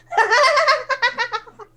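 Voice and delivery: high, rattling cackle; laughing. Acted. Neighbour laughing through the walls, high cackle. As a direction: burst into a high staccato laugh. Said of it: "Is that laughing?".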